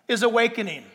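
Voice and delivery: ordinary talk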